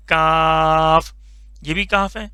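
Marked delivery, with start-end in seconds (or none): 0.1-1.1 کاف held long and level; drawn-out kaf. Shown as a demonstration. Teacher names the letter for pupils, held long. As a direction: stretch کاف out slowly. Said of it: "اس کو آپ نے یاد رکھنا ہے".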